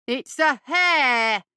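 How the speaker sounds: slow, loud shouted insistence; insistent shout. Acted. Boyle insisting to Radley about the hare, slow shout. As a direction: shout it, drawing it out to make him listen. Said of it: "Boyle insisted again".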